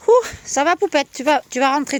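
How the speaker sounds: high, coaxing voice for a little pet; pet talk. Real, not acted. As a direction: coo at the animal to lure it in. Coaxing her pet into the hutch.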